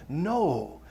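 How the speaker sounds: soft, slow, drawn-out words; a hint of a wise old baboon; slow knowing hint. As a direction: gently urge him to look. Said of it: "the pivotal words there".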